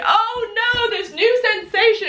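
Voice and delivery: high pretend panic at a new feeling; hammed-up dismay; mock alarm. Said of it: "It wasn't like".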